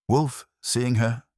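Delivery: usual speaking voice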